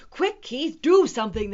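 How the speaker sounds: loud, high plea; urgent cry. A narrator's reading. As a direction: cry out for help fast. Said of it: "they cried".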